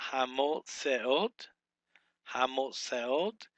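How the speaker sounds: Hamotzeot said slowly as ha-mo-tze-ot, rising at the end; slow pronunciation demo. Shown as a demonstration. Teacher to Hebrew students, slow careful demo. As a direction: sound out Hamotzeot carefully for the class. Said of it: "Be four syllables".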